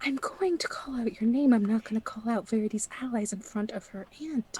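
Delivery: insistent stage whisper. Acted. Announcer refusing to name allies before the aunt, whispering. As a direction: argue the point in a whisper. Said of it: "are you whispering?".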